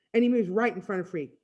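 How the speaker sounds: ordinary delivery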